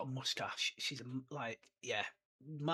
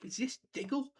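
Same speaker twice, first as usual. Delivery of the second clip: much higher, eager wondering; hope it's him, voice lifting high; excited wondering; 9 semitones higher than usual. Retold or imitated. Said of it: "it's just like".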